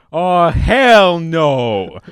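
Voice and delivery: loud, high, slow gangster scoff; drawn-out street-tough refusal. Retold or imitated. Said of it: "using gangster, gangster language".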